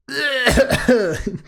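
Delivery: loud, high acted coughing; bad coughing fit. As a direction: cough hard, cutting off your own sentence. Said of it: "My coughing is so bad".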